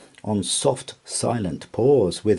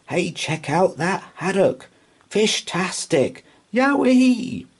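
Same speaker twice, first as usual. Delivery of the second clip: high-pitched, whooping delight; gleeful cats crowing over the catch, building to a squeal; great glee; 11 semitones higher than usual. A narrator's reading. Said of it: "popped up with great glee".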